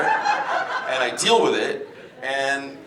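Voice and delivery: normal talking voice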